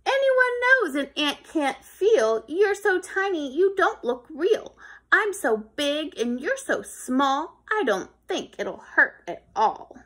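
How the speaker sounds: a hint of a scornful boy; dismissive kid. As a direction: brush the tiny thing off. Kid brushes off the ant.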